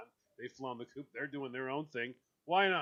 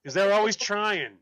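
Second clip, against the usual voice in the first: much louder, emphatic; exasperated; 4 semitones higher, 12 dB louder than usual. Real, not acted. Host complaining about hosts who keep pushing food, louder.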